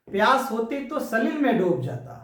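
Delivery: declaimed Hindi verse; poem recitation. Acted. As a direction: recite the line with lift, falling at the end.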